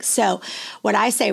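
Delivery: ordinary talk, nothing marked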